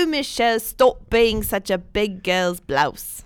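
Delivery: hammed-up English scolding, drawn out; put-on English accent. Host voicing London friends teasing her, mock English accent. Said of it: "that's not even how Tom Hardy sounds".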